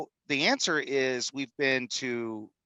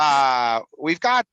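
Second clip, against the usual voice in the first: a bad German accent that falls apart as he tries; self-conscious hammed-up German accent; 7 dB louder, much slower than usual. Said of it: "I can't even do it".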